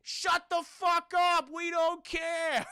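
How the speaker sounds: slow, high shout across the street; yelled from afar. Retold or imitated. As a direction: bellow it back from across the road. Neighbour yelling across the street.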